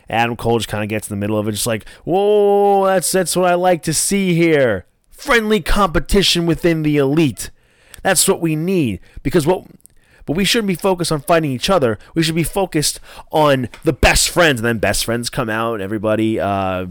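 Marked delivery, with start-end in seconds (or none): 2.0-9.0 high-pitched, hammed-up interjection; put-on higher voice breaking up a dispute; acted peacemaker. Voices wrestler stepping between teammates. 9.2-14.6 high-pitched, hammed-up interjection; put-on higher voice breaking up a dispute; acted peacemaker. Voices wrestler redirecting his teammates.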